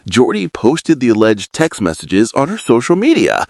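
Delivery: usual speaking voice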